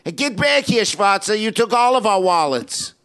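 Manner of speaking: hammed-up Yiddish accent, pleading yell; mock Yiddish accent crying for help. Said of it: "He's yelling".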